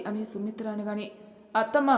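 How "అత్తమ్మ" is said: a sharp, raised cry of protest, higher and louder; angry call. Acted. Daughter-in-law bursting in on mother-in-law, angry call. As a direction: storm in calling her out. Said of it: "అంటూ కోపంగా లోపలికొచ్చింది".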